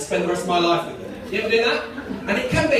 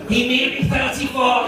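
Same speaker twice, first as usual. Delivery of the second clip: louder, higher botched Scottish sliding into Geordie; Scottish gone Geordie; 5 semitones higher, 5 dB louder than usual. Acted. Comedian retrying his Scottish analyst voice, accidentally Geordie. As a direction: attempt Scottish and land in Geordie. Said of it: "he's gone Geordie, for fuck's sake".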